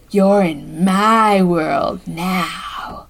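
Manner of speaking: slow, low menacing voice; sinister magician. Retold or imitated. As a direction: drag it out like a villain. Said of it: "the magician's face going".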